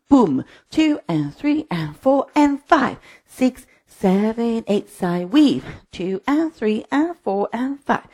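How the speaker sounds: rhythmic, lifted cues riding the beat; line-dance instructor's sing-song count; dance step calling. Real, not acted. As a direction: call the routine in time for a class. Calls the whole routine in time.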